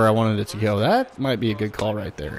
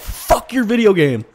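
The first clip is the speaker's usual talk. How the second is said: retelling an outburst, higher pitch; retold angry retort; 7 semitones higher than usual. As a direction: punch out a retold insult. Retells his retort to someone.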